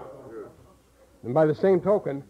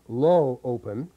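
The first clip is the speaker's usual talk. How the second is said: slow, lower demo of the low open voice; 6 semitones lower, 4 dB quieter than usual. Shown as a demonstration. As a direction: drop the jaw and let it sound low and open. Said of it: "subheading G".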